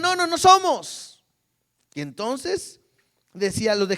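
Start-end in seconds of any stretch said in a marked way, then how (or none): 0.0-1.2 panicked denial. Retold or imitated. Fugitives denying in panic.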